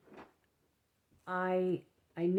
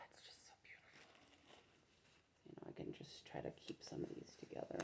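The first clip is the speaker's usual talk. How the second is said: whispering; 19 dB quieter than usual. Real, not acted. Admiring yarn softly.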